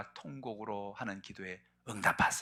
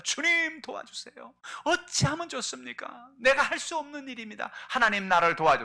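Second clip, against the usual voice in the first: high, pleading cry to God; tearful pleading prayer; 12 semitones higher, 6 dB louder than usual. Retold or imitated. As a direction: beg God through tears, voice climbing. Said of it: "눈물을 흘리며 기도합니다". (높은 목소리로 울먹이며 간구)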